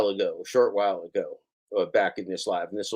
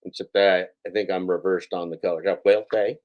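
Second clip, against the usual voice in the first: ordinary talk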